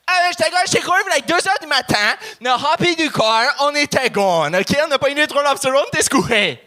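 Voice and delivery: high, fast-flowing mock chiac from Moncton; Moncton chiac accent. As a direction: rattle it off like his Acadian buddies. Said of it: "parler comme mes amis de Moncton". (imitation de l'accent chiac de Moncton)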